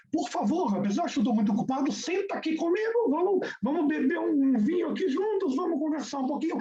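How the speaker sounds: high, quick, delighted host greeting an honoured guest; eager welcome. Acted. Host Avraham Gershon welcoming Rashi: high, eager delight. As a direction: gush over the famous rabbi, words tumbling.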